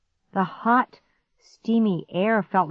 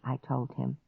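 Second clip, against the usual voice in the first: usual speaking voice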